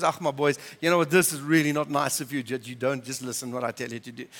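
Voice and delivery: low, mild teatime reproach, fading soft; mock-gentle scolding. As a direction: pretend to chide them very kindly. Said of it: "I don't think he says".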